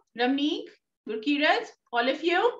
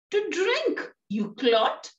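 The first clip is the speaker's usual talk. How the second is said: high-pitched acted irritation; an exasperated retort, starting high; very angry; 7 semitones higher, slower than usual. Mother answering her son about the stout, angry. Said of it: "So she's very angry".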